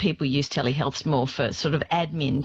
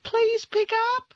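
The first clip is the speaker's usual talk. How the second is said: high, slow, drawn-out please; exasperated plea; slower than usual. Real, not acted. Doctor begging patients who miss calls, exasperated plea. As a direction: beg them to just answer.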